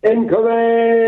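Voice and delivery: high, loud, drawn-out call; playful shout. Acted. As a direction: announce your arrival like a siren. Caller bursts onto the line.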